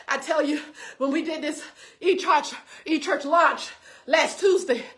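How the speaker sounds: ordinary talk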